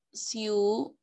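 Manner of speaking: "Siu" drawn out as one syllable; syllable demo. Shown as a demonstration. Teacher repeating the first syllable for pupils, syllable demo. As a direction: sound out "Siu" unbroken, on its own. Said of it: "mi primera sílaba estará formada por".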